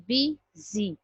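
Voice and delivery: "B Z" as two separate beats of busy, bi - zy; split into syllables. Shown as a demonstration. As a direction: pause between the halves of busy, "B Z". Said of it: "my tongue is moving two times".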